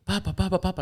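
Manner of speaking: put-on high, eager little-boy calls; child calling. Dad imitating his son calling for him. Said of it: "he'll go".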